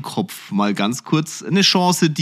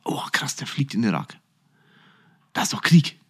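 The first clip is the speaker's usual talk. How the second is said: hushed inner thought. Retold or imitated. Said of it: "mein erster Gedanke war".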